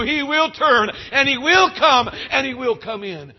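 high, loud, insistent preaching; full-force preaching. Acted.

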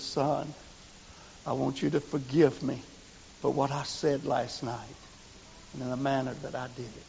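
soft, sincere plea; preacher apologizing to the young man; tender apology. Retold or imitated. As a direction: ask forgiveness gently.

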